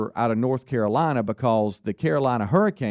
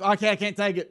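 higher-pitched mock of the radio guy; put-on voice quoting the host; imitating radio host; 7 semitones higher than usual. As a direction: mimic an exasperated caller-hater, pitched up. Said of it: "How about when the guy goes".

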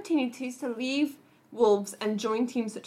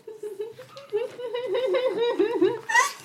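a high, bright laugh breaking out; amused high laugh; high burst of laughter; 11 semitones higher than usual. Real, not acted. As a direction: laugh hard at the joke. Laughs at the Liverpool joke.

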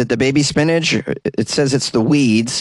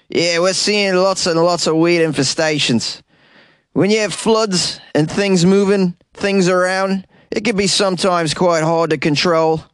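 higher put-on Australian accent; mock Australian farmer; 7 semitones higher than usual. Host voicing an Australian expert with a put-on accent.

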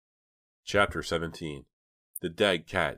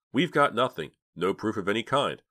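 higher, louder bitter listing; 5 semitones higher, 5 dB louder than usual. A narrator's reading. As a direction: drive each point home bitterly. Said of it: "Dina interrupted bitterly".

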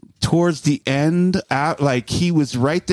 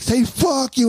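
high-pitched goading call; imitated stage call-out; 10 semitones higher than usual. Rapper goading the crowd, imitated call-out. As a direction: egg the crowd on in raised pitch.

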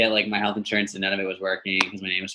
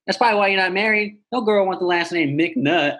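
higher-pitched imitation of a teasing old lady; Tony; 9 semitones higher than usual. Insurance agent mocking caller's surname, imitated teasing. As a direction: needle him like a cheeky older phone agent.

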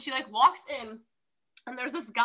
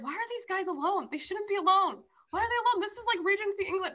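higher, incredulous questioning; mock baffled; 8 semitones higher than usual. A reader puzzling over why the couple is left alone, higher scandalized tone. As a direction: raise the voice in scandalized disbelief.

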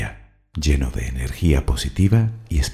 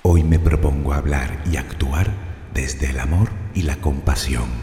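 ordinary talk

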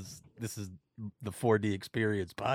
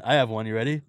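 normal talking voice

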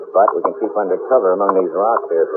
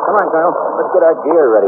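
urgent raised voice over engine noise; calling over engine; 10 semitones higher than usual. Acted. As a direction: shout orders above the plane. Shouting over plane engine.

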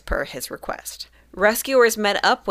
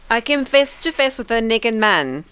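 higher-pitched put-on French accent; 5 semitones higher than usual. Showing the co-host how the Frenchman sounds, French accent. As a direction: read it as a cartoon Frenchman. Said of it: "I can't do a French accent to save my life".

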